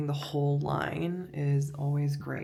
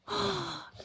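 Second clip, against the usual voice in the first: sharp high gasp of delight. Real, not acted. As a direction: gasp at something cute. Sees the watch.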